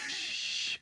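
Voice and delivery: long soft "shh"; shushing hiss. Retold or imitated. Husband hushing his wife during the Santa call, a breathy shush. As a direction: shush someone quietly.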